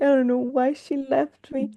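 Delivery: half-hearted weepy wail; mock crying. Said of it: "she was crying".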